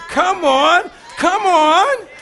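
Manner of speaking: high, loud call to the crowd; rally the congregation; loud urging. Real, not acted. Preacher rallying the congregation with a loud high come on.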